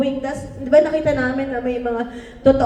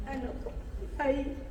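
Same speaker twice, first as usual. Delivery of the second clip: ordinary talk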